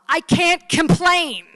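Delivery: loud, high, drawn-out cry; hammed-up shouted punchline; exasperated outburst. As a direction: blow up on it, stretching each word. Patient finally bursts out, punchline.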